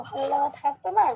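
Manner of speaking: usual speaking voice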